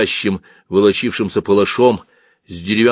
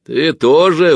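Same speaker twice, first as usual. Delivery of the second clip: raised, louder, half-doubting question; cheerier but distrustful; 9 semitones higher, 7 dB louder, slower than usual. Acted. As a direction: ask more cheerily, still not quite believing. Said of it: "уже веселее, но ещё с недоверием". (веселее, но с недоверием)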